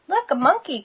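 high, delighted pointing-out; excited cry. A narrator's reading. Kitten spotting a monkey at the zoo, excited cry. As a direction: call attention to it excitedly. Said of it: "cried Kitten".

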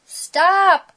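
high, loud shout; crying out. A narrator's reading. Splat tries to halt Seymour's chase, crying out. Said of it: "cried Splat".

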